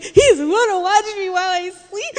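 high, tearful mock wail; whimper-wail it like a scared toddler; terrified small child. Terrified daughter afraid of Santa, high tearful wail. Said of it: "This child is terrified".

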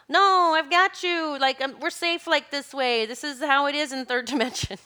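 cling on, insisting you are safe; protective stubborn body. Acted. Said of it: "And the physical body's like".

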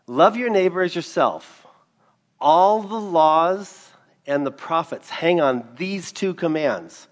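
loud, high proclaiming; fervent preaching. Acted. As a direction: drive the commandment home. Preaching at full force.

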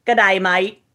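a quick Australian "Good day, mate" with flat broad vowels; Australian accent. Pretend. Asked by the host for an Aussie line, a light Australian accent. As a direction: toss off a cheerful Aussie greeting. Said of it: "Say "Good day, mate" in an Australian accent".

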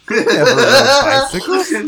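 loud, high, laughing outburst; indignant shout. Retold or imitated. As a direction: shout it, cracking up.

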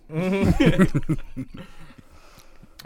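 loud high laughing fit; burst of laughter. Real, not acted. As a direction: crack up loudly. Laughing at the joke.

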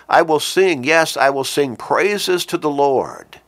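loud fervent sermon reading; preaching the psalm. Acted. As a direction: swell up to I will sing. Reads psalm with rising fervor.

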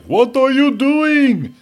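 very high, slow, piercing falsetto shriek; foreign neighbor's voice. Retold or imitated. Neighbor yelling at his bratty kids upstairs, shrill falsetto. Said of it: "the voice like".

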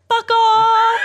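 gleeful shouted kiss-off; shouted fuck off. Real, not acted. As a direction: yell it out with glee. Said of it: "because I have the platform to say it now".

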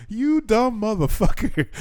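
high, slow scornful jab; scornful insult. Retold or imitated. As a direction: drag out the insult in disbelief. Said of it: "But I was just like".

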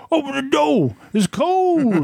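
high, drawn-out pleading whine; mock high-pitched caller outside; Fred. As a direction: wail it like a pest at the door. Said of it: "it's usually Fred".

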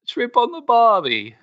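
high, slow hammed-up Australian; cheesy Aussie accent, drawn out; mock Australian accent. Host demonstrates his go-to bad Australian accent. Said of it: "Okay, there's Australian".

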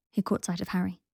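ordinary delivery

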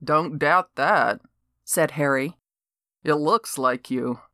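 lightly voiced skeptical neighbor; lightly voiced Harry. Harry teasing Vini.

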